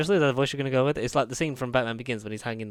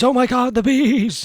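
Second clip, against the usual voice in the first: high, loud panicked cry; mock panic; 11 semitones higher, 11 dB louder, slower than usual. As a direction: scream about the bees. Nicolas Cage bees scream.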